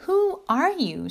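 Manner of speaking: put-on high, slow childlike question; curious child. A narrator's reading. Lucy meeting the little person, high curious question. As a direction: voice a little girl, pitched high.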